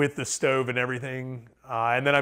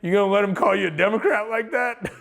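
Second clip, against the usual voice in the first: laughing; 8 semitones higher, 3 dB louder than usual. Retold or imitated. Teasing friend, laughing.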